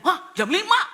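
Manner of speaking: sudden high, loud gasp of surprise; startled. Acted. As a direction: jump at the time on the clock. Pak Udin startled by clock. (kaget, nada tinggi)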